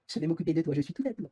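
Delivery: mock-seductive nurse, lightly voiced; Didine. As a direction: jokingly coo it like a doting nurse. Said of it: "Didine va se déguiser en infirmière".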